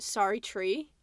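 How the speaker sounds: "So true" said as "Sorry, tree" in mock Australian; put-on Australian vowels, "true" turned to "tree"; Australian accent. Telling co-host about a catchphrase, mock Australian accent. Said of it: "doing that with Australian accent".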